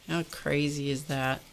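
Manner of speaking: normal talking voice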